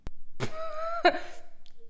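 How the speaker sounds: bursts into high laughter; a genuine laugh over the book joke; real laugh. Real, not acted. Laughing at her own book joke to viewers.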